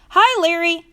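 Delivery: bright high-pitched hello, calling out; called-out greeting. A narrator's reading. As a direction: call a friendly hello across to someone. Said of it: "she called out".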